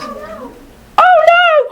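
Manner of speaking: put-on very high scared child's wail; frightened cry. Penelope frightened by the villain, very high cry. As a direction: squeal it in fright. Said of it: "cried Penelope".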